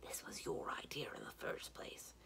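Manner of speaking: hushed, low whisper; said softly. A narrator's reading. Mendibar to Simrine outside the gate, very softly. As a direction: whisper so only your companion hears. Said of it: "Mendibar said softly to Simrine".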